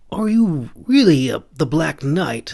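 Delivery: put-on high boyish voice asking in awe; a wide-eyed kid asking; young stable boy, amazed. A narrator's reading. Stable boy asks, child voice.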